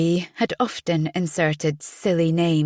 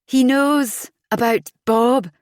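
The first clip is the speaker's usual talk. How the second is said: panicky; 7 semitones higher, 5 dB louder than usual. Acted. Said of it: "Calm down".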